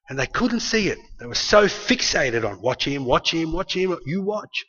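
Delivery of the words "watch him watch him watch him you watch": suspicious muttering. Retold or imitated. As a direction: whisper it like a suspicious plotter. Rulers fixated, whispering again.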